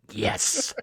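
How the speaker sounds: put-on thrilled whispered yes from a kid; breathless kid who can't believe his luck; excited whisper. Kid thrilled to swing at piñata.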